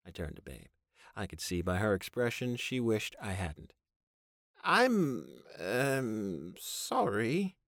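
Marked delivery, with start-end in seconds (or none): 4.6-7.6 high, hesitant apology; stammering. A narrator's reading. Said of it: "she stammered".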